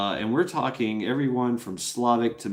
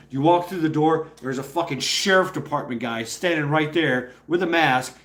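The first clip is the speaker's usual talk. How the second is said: normal talking voice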